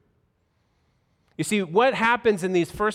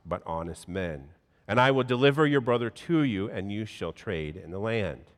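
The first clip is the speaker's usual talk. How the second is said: measured, formal Bible reading; scripture reading; 7 semitones lower than usual. Acted. Pastor reading Genesis to the congregation, solemn formal reading. Reads scripture passage aloud.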